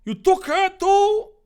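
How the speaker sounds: very high, loud, disbelieving cry; hammed-up horrified protest; outraged yell. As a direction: shriek the accusation. Dave yells at villain.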